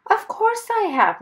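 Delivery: high, loud indignant retort; cross. A narrator's reading. Mister Wumble to the airplane man after his fall, cross. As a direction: answer crossly, pitch leaping up. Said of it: "Mister Wumble said crossly".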